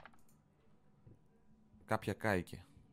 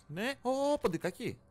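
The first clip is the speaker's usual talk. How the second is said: startled; 4 dB louder, slower than usual. Real, not acted. Spots a mouse.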